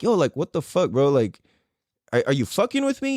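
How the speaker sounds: usual speaking voice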